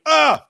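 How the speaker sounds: high, loud mock gasp; short retold cry of shock; startled yelp. Friend seeing the pants tent, startled yelp.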